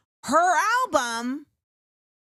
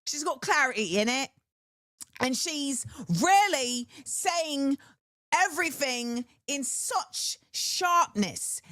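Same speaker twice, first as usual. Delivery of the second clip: put-on British accent, slowing down; slower than usual. Reviewing the British rapper's album, put-on British accent. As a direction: stretch it out in a mock British accent. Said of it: "talk about it in a British accent".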